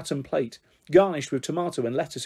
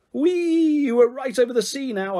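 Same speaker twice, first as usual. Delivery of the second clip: put-on gleeful child shouting from the swing; excited shout; 9 semitones higher than usual. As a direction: squeal it like swooping on a swing. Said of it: "I shouted".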